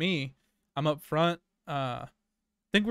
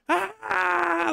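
sudden very high, piercing mock scream; imitated scream; 3 dB louder than usual. Imitating the lady screaming while shaving her head, high shriek. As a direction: shriek like the crazed lady in the bathroom. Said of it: "like, screaming".

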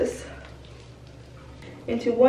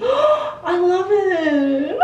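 excited gasp; 10 semitones higher, much slower than usual. Real, not acted. Gasps at new hair color.

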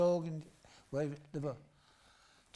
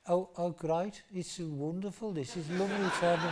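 higher, posh person's mock Liverpool accent; bad Liverpool accent; 5 semitones higher than usual. Posh hosts welcoming Liverpool visitors, badly faked Liverpool accent. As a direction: badly fake a Liverpool accent as a gushing host. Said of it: "they'd always go into a Liverpool accent".

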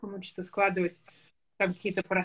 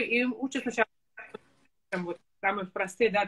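usual speaking voice

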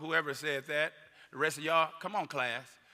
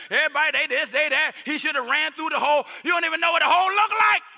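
loud, high, mocking voice of a know-it-all parent; mocking armchair critic; 10 semitones higher, 10 dB louder than usual. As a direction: jeer like a sideline loudmouth.

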